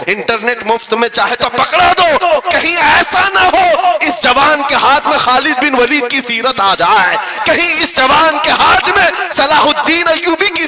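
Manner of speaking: loud, high rally oratory; impassioned sermon at full force; fiery preacher. Real, not acted. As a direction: hurl it at the crowd.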